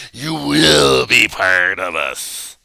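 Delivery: slow, loud, threatening preacher; slow, loud, threatening preacher tirade; dramatic preacher rant. Shown as a demonstration. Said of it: "Notice the dramatic acting".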